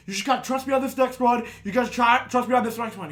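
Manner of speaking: worked-up acted insistence; urging driver quote. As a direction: imitate a friend urging everyone to trust him. Acts out driver urging the group.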